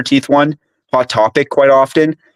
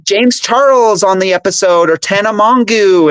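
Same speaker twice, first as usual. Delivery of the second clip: higher, bouncy mock host greeting; imitating the podcast host; 7 semitones higher than usual. Mocking the host's opening to his friend, higher and bouncy. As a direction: ham up a chirpy podcast intro. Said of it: "and he's like".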